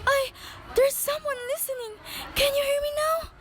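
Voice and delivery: barely audible, terrified plea; frightened whisper. Acted. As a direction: whisper into the phone so no one downstairs hears. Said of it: "Please speak louder".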